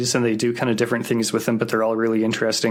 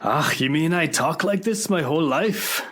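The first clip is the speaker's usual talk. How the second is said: mock Scottish accent; 6 semitones higher than usual. Joking Scots struggling with their accent.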